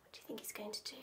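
hushed unvoiced aside; whisper. Real, not acted. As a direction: lean in and whisper. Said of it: "aside to listener".

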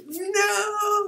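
very high, loud wailed no; panicked mock scream. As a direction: scream it in hammed-up horror. Acts out his panicked reaction.

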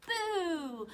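high, playful boo; mock scare for toddlers; playful peekaboo. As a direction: pop out at little children with a boo. Peekaboo in hiding song.

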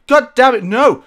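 loud, sudden frustrated yell at the game; angry shout. Real, not acted. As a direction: explode in real annoyance as the fire hits you. Sets himself on fire again.

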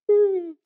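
high contented hum; happy hum. Acted. Pastor humming awake in bed. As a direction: hum it happily.

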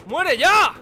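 loud frustrated shout; angry yell. Real, not acted. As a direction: scream it at the enemy. Yelling at enemy.

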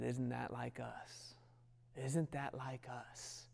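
slow breathy whisper of God, very soft; a hushed voice of God speaking to us. Acted. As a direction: lean in and whisper it slowly.

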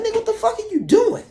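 high, squeaky angry uncle; mock angry uncle.

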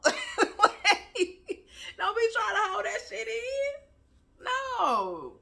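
high-pitched, drawn-out mock exasperation; mock scolding. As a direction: scold playfully, voice pushed way up. Acting out her scolding of non-criers.

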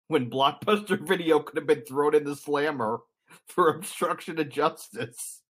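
talking through laughter, pitched high; laughing. Real, not acted. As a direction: crack up at your own joke while saying it. Joking about Blockbuster going to jail.

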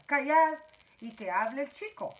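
loud commanding ladybug. A narrator's reading. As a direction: order them to hush. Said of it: "ordenó la mariquita".

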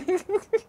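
a burst of high laughter. Real, not acted. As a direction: laugh at the punchline. Laughs at her punchline.

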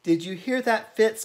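high, loud call to a pet; Miss Perkins calling out. A narrator's reading. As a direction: call out so the hidden cat hears. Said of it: "called Miss Perkins".

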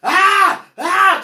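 loud, high-pitched "Ah!" screams; re-enacted scream. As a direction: scream it out as in the retelling. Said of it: "I started screaming".

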